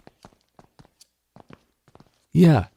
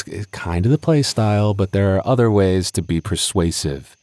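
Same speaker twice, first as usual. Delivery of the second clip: ordinary talk, nothing marked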